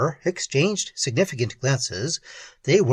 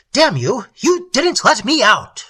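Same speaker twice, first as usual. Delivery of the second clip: loud, high-pitched fury; furious accusing shout; ferocious outburst; 10 semitones higher, 7 dB louder than usual. A narrator's reading. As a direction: explode at them in sudden ferocity. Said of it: "ferocity with which these last words were delivered".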